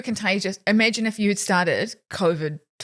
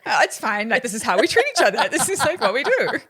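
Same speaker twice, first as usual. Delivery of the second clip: high breezy cover-up; lightly hammed-up reassurance; mock breezy cover; 4 semitones higher than usual. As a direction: brush it off brightly, covering awkwardness. Covering awkward silence.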